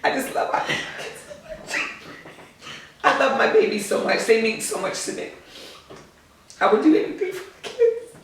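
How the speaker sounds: real tearful laughter, breath catching. Real, not acted. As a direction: let the voice crack as the tears come. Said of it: "it makes me wanna cry".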